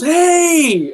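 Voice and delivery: high, loud friendly shout; playful shout. Retold or imitated. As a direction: shout hey at an excited fan. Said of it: "and he goes".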